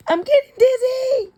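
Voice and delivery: put-on high, loud whiny child's cry; high whining child, dizzy and upset; dizzy kid. A narrator's reading. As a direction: wail it like a kid spinning on a bus. Kid on spinning bus.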